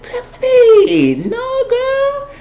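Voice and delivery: very high, drawn-out mock wail; stretched-out high cry of dismay; exasperated pleading. Viewer scolding Tiffany for getting high, exasperated pleading. Reteller scolding the drunk character.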